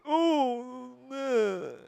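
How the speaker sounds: a hint of a mocking character; brief high mock sigh. Start of another mocking quote.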